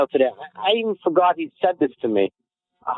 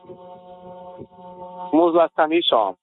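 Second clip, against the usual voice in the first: slow mocking jab; 3 semitones higher, much slower than usual. Slow jab back at friend.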